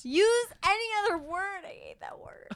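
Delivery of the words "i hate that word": breathy whisper of disgust. Real, not acted. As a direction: hiss it under your breath.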